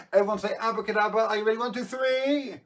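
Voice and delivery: high, loud, fast countdown cheer; rally the kids to shout along; kids party magician. Acted. Magician leads kids' countdown.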